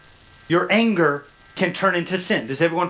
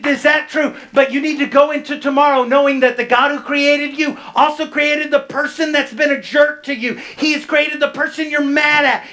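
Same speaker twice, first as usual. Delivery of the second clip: higher, louder, driving sermon climax; preaching at full force; 8 semitones higher, 6 dB louder than usual. Acted. Pastor urging the congregation to let go of anger, preaching at full force. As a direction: build and preach it with full conviction.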